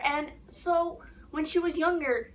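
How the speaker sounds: ordinary delivery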